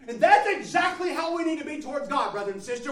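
high, loud, driving sermon delivery; impassioned pulpit shout; full-force preaching. Real, not acted. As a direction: preach it out at the top of your range.

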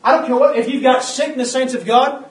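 loud, rising sermon cadence; preaching at full force. Acted. Preacher urging saints to shine the word, preaching at full force. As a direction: hammer each line home to the church.

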